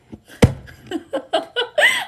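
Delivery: very high loud cackle; shrieking laugh. Real, not acted. Reader cracking up at her own advice, squealing.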